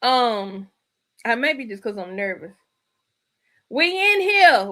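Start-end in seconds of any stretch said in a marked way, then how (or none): 3.7-4.7 loud, high hype; hyped call-out. Real, not acted. Host hyping up the live audience, loud call-out. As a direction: shout it out to the room. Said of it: "hyped greeting to viewers".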